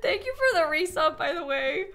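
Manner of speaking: ordinary talk, nothing marked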